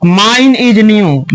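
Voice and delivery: each word read out very slowly and clearly; slow dictation. Shown as a demonstration. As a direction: lay the sentence out slowly for the pupils. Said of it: "मेरा नया है". (बहुत धीरे, साफ़-साफ़ बोलकर)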